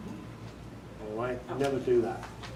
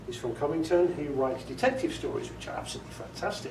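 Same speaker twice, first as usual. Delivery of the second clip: ordinary talk, nothing marked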